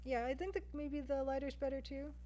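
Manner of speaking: ordinary talk, nothing marked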